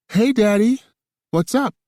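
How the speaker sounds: high, loud bright phone greeting; high female voice. A narrator's reading. Woman answers phone.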